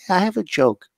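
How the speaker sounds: put-on high, sweet little girl voice; little girl telling her joke into the mic. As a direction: tell it like a proud five-year-old. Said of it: "And she goes".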